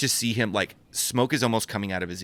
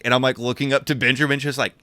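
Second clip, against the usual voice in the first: ordinary talk, nothing marked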